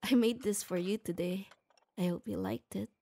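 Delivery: ordinary talk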